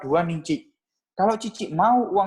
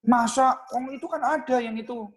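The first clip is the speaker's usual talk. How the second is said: higher-pitched doubting customer; incredulous objection; 9 semitones higher than usual. Acted. Customer doubts the agent's no-fee claim, incredulous. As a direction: push back in mild disbelief.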